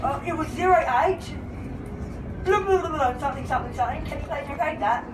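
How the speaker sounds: ordinary talk, nothing marked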